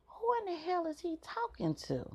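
high, hushed disbelief; puzzled at the giggling; incredulous thought. Retold or imitated. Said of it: "I'm like".

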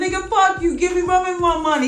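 retold furious demand for pay; mock angry yell. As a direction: yell at him for the money, half laughing. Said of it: "I said".

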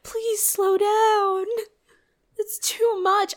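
high, slow fake sob; mock crying plea. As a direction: beg through pretend tears. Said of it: "and you're like".

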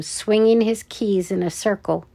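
ordinary delivery

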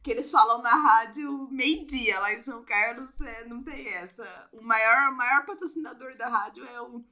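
normal talking voice

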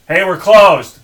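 loud call across a parking lot; shouted from afar. Retold or imitated. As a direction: yell it to someone far away. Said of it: "and he goes".

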